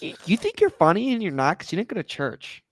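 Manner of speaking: mom scolding. Retold or imitated. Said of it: "She's like".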